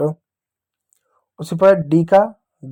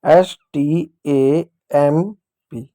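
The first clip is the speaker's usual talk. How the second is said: slow spelling out; much slower than usual. Shown as a demonstration. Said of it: "अगर ना समझ में आए तो ऐसा आप समझो".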